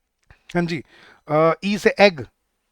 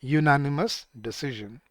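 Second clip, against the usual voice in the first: answer read slowly; 3 semitones lower, much slower than usual. Shown as a demonstration. Teacher giving students the answer, slow and lowered at the end. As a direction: deliver the answer slowly, pausing after "a", settling down. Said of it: "क्या लगेगा यहाँ पे?".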